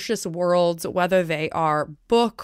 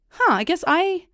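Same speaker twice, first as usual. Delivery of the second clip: high, sudden dawning realization; surprised realization; 8 semitones higher than usual. Retold or imitated. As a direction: catch yourself, surprised. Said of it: "you're like".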